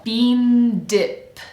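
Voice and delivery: "Bean dip" drawn out, n held into d; linked n to d. Shown as a demonstration. Coach models bean into dip, stretched linked demo. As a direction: hold the n in "Bean dip", then release d. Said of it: "From the n sound of bean".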